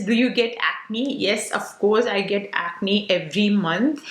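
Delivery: normal talking voice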